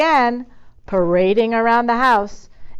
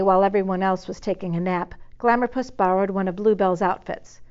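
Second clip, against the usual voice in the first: ordinary delivery, nothing marked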